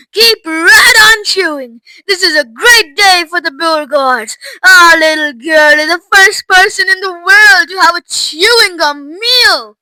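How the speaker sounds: big, loud crowing proclamation; proud boasting. A narrator's reading. Mister Beauregard boasting of his little girl, loud and proud. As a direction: trumpet it like a proud father.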